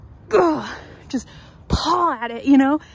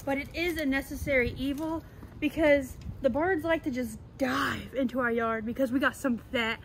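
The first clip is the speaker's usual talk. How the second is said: ordinary talk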